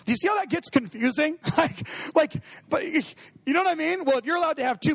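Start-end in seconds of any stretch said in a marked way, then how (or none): 1.6-2.3 high airy laugh through the words; a light real laugh; breathy giggle. Real, not acted. Laughs mid-sentence.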